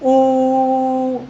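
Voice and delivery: long vowel demo. Shown as a demonstration. Teacher sustaining ऊ for the class, drawn out. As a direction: hold ऊ steady and long. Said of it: "देर तक उच्चारित करें".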